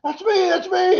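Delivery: high, excited imitation of a winner; excited claim. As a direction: call it out like the lucky ticket holder. Winner claims prize, retold.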